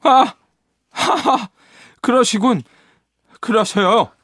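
high mocking laugh and retort; sarcastic laugh. A narrator's reading. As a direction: scoff at her, high and sarcastic. Sarcastic reply in quarrel.

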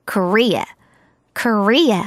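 Korea with a light "Ko" and a strong "RE"; stress on second syllable. Shown as a demonstration. Teacher models English pronunciation of Korea, stress on second syllable. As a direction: hit the middle of "Korea", not the start. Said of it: "코를 강하게 읽지 말고 리를 강하게 읽어주세요".

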